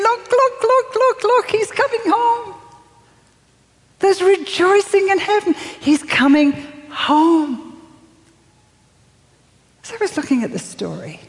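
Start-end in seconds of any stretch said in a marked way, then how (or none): 0.0-2.5 very high, sustained heralding call; jubilant calling out. Acted. Angels announcing he's coming home, jubilant high call. 4.0-7.6 high rejoicing, slowing on the last line; exultant proclamation. Real, not acted. Preacher celebrating the son's return, exultant.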